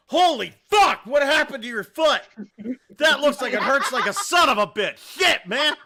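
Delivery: loud, high, shocked yelling; a guy yelling in horror at a wounded foot; shocked shout. Retold or imitated.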